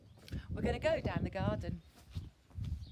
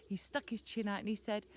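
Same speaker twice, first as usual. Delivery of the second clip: ordinary delivery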